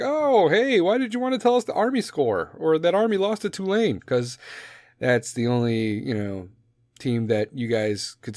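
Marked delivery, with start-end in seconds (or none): 0.0-4.0 high, mock-innocent asking; sarcastic fan jab. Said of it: "And you're like".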